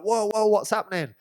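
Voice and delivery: high, startled whoa from the group; mild mock alarm. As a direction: sound caught off guard. Friends turning round in alarm.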